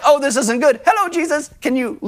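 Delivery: high-pitched, understated little plea; mock polite concern. Preacher mimicking an unworried Peter calling Jesus, mock polite. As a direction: play down the emergency in a high voice. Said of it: "It isn't like".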